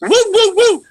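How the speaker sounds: put-on high, excited old lady calling woo; old neighbor's call. Eighty-five-year-old neighbor cheering a booked job, high call. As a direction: holler it like Rhoda greeting you in the hall. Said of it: "So we're gonna do a Rhoda".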